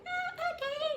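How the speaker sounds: imitating her crying self, very high; tearful. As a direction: squeak it out through tears. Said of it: "And I was like".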